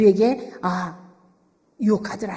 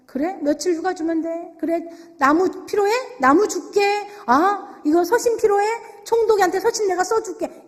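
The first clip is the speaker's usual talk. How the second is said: higher-pitched, easygoing generous grants; obliging king; 7 semitones higher than usual. Acted. King casually giving Nehemiah leave, wood and letters, obliging. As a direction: grant each request readily, voice lifted.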